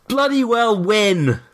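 slow, stretched rallying cry; pep talk. Acted. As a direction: drag it out like a cheesy coach. Mock motivational pep talk.

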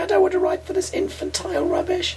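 high-pitched whiny plea; teasing impression of a desperate hopeful; mock pleading writer. Mocking the friend's TV ambition.

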